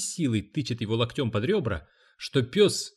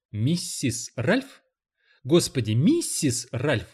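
plain reading voice

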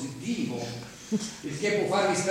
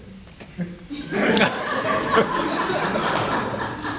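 risata sadica; 2 semitones higher than usual. Acted. Selezionatore ride sadico.

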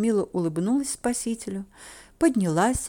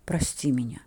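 hushed, lower, caring voice; tender apology; 7 semitones lower than usual. A narrator's reading. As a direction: apologise gently to a sweetheart. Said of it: "вздохнул он".

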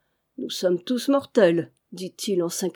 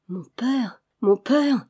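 understated tearful lament; a touch of grief, swelling on the repeat; murmured through sobs; 3 semitones higher, slower than usual. A narrator's reading. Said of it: "murmurait-elle au travers de ses sanglots".

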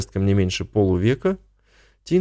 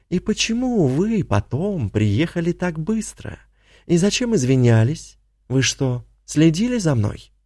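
cornered and desperate; 7 semitones higher than usual. A narrator's reading. Tina pressing after.

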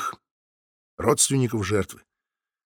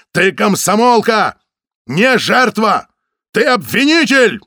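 slow, very high, loud shout; furious shout; 9 semitones higher, 8 dB louder, slower than usual. A narrator's reading. Samoylov shakes her, slow furious shouting. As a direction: scream each phrase at her.

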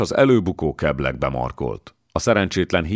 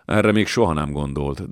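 plain reading voice

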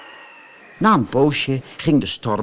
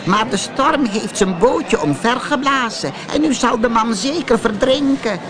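put-on a higher, worried old woman's voice; a villager explaining the lost father; 11 semitones higher than usual. A narrator's reading. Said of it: "zei een oude vrouw".

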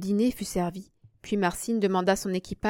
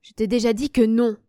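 firm, louder, somewhat dry refusal; curt refusal; 3 semitones higher, 7 dB louder than usual. A narrator's reading. Friend refusing to sell his dog, curt and dry. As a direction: refuse with a dry, emphatic edge. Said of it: "avec quelque sécheresse".

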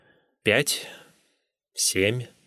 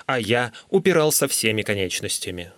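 ordinary delivery

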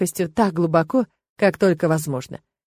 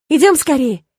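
excited call; 6 semitones higher, 6 dB louder than usual. A narrator's reading. Irma calling Lena to the dancing, loudly excited. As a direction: call your friend over eagerly. Friend urging her towards the dancing.

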